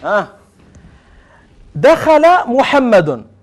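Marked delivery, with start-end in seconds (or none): 1.8-3.3 دخل محمد said slowly, each word drawn out and loud; slow example sentence. Shown as a demonstration. Teacher giving the class an example sentence, dictated slowly. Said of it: "أقول مثلًا دخل".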